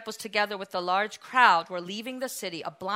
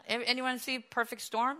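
ordinary talk

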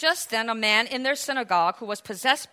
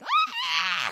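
thin, very high imitated shriek. Spirit leaving the man with a shriek, screaming. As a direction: let out a high mock scream. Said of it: "there was a shriek".

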